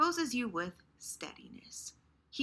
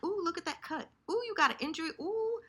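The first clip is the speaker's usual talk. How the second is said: mocking tease; 9 semitones higher, faster than usual. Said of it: "he's kinda, like, laughing at it".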